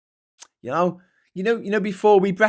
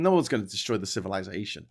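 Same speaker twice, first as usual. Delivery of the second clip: ordinary talk, nothing marked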